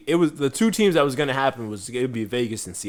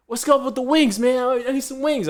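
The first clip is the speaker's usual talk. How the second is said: much higher, louder New York barber voice; imitating Ray the barber; 13 semitones higher, 4 dB louder than usual. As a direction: hype it up like a short New York barber on the phone. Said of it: "He's like".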